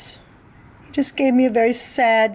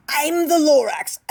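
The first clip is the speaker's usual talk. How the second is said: higher, fussy little creature voice; Lorax voice; 7 semitones higher than usual. Acted. Lorax confronts the Onceler, higher fussy voice. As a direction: announce yourself as the Lorax, higher than the narration. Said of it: "he came back with more gripes".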